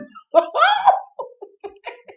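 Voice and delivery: high, loud burst of laughter. Real, not acted. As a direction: crack up high and hard. Laughing at her mistake.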